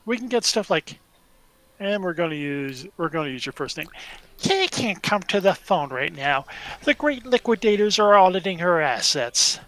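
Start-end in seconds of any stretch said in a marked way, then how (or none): 4.4-9.6 loud, drawn-out Ferengi answering-machine announcement. Acted. Ferengi recording a phone greeting for a customer, hammed-up Ferengi voice. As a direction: put on a Ferengi voice, pitched up and forceful. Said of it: "I'll get my Ferengi voice on".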